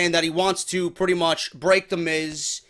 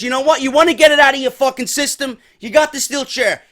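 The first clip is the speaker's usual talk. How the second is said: higher, louder mock challenge; agitated challenge; 7 semitones higher, 6 dB louder than usual. Seth daring Dean to swing the chair, heated mock challenge. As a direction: dare him, heated. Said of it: "So he says".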